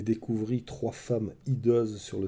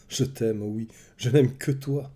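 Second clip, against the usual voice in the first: ordinary delivery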